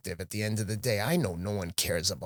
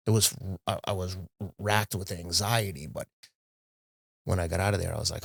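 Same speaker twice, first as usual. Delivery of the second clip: usual speaking voice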